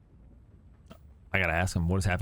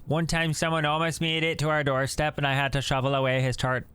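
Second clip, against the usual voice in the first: put-on light Canadian accent, folksy; cheerful Canadian old-timer; 6 semitones higher than usual. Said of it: "This guy's Canadian".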